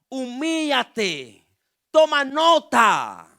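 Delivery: slow, drawn-out commands rising to a loud call; emphatic pulpit urging; preaching exhortation. Real, not acted. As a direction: stretch out each command for the congregation.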